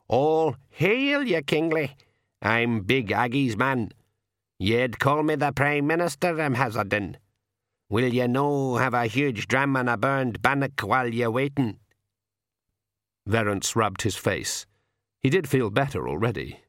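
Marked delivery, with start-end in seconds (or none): 0.1-11.8 hearty Scots-like pixie dialect; old pixie. A narrator's reading. Old pixie introduces himself and offers a dram, dialect voice. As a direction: hail and welcome in a put-on pixie voice.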